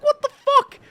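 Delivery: high, loud squeal of disbelief; shocked outburst. Retold or imitated. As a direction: shriek it in comic disbelief. Acted shocked reaction to road blockage.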